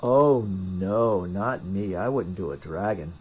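low, sing-song mock innocence; mock denial. Answering a viewer who asks about dragons, mock denial.

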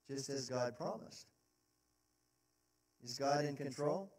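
dropped soft and low after the peak; quiet preacher aside; sudden hush. Real, not acted. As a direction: pull it right back to a near hush.

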